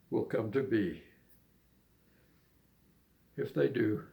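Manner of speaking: unhurried spoken verse; poem recitation. Acted. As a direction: recite it gently, line by line.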